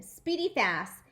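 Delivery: ordinary delivery, nothing marked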